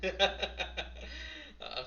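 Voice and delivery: slow words through real laughter; laughing. Real, not acted. Streamer admits teasing his viewers, laughing. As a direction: laugh while you own up to the joke.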